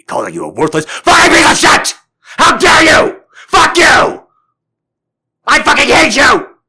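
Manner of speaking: screaming furious abuse, pitch way up; enraged screaming. Shown as a demonstration. Screaming at someone who triggered him, full rage. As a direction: explode in a raging scream. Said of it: "I would be screaming at you".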